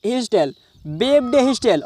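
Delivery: very slow, word by word; slow repetition. Shown as a demonstration. Teacher repeating the English phrase slowly for students. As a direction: drag the phrase out for learners.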